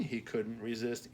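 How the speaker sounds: ordinary delivery, nothing marked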